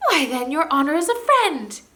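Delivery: high, loud squeaky voice, suddenly friendly; relieved gnome. Acted. Relieved gnome greeting the prince as a friend, high and squeaky. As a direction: brighten up like a freed little creature.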